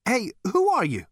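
high, loud alarmed demand; panicky panting. A narrator's reading. Zaphod to the unseen voice, panicky shout. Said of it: "panted Zaphod".

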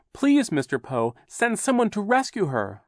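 loud, urgent plea rising high; crying out. A narrator's reading. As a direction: beg for a rescue. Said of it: "Violet cried".

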